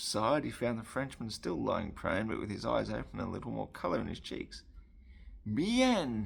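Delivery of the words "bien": high, louder French exclamation; French accent. A narrator's reading. Frenchman welcoming the wine being poured, French accent. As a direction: say it brightly pitched in a French accent.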